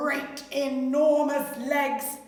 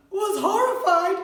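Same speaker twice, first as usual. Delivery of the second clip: ordinary delivery, nothing marked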